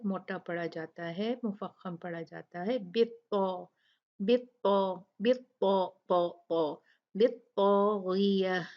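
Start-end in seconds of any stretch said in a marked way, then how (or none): 2.8-8.6 बित्ता with a thick, full ta; heavy ta. Shown as a demonstration. Teacher drills the heavy letter ta for students. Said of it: "बहुत ही strong पढ़ा जाता है".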